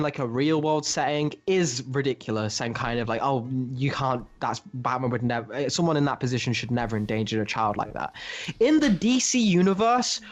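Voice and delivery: ordinary talk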